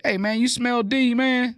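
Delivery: high-pitched nosy question; high, rising imitation of a gossip; mock gossiper. Acts out whispering gossipers.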